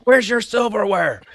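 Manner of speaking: high, loud demanding shout; drill sergeant yell. Retold or imitated. Drill sergeant confronting recruit without silverware, shouting. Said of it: "He's like".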